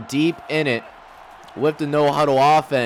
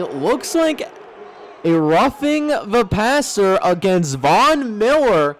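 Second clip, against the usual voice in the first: excited; 4 semitones higher, slower than usual. Real, not acted. Penalty flag on big play.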